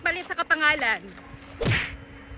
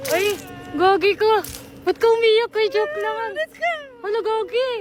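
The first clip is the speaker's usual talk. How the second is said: louder, higher, startled concern; raised-voice fussing over a crying friend; alarmed apology; 5 semitones higher, 4 dB louder than usual. Real, not acted. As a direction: blurt it out, realizing the joke made a friend cry. Friend cries, speaker says only joking.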